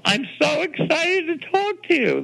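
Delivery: high gushing mock voice; lightly voiced gushing fan; imitated excited editor.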